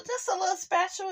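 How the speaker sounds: ordinary talk, nothing marked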